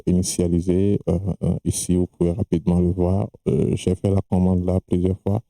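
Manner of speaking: usual speaking voice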